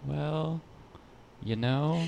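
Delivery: sheepish relenting. Acted. Same viewer relenting a week later.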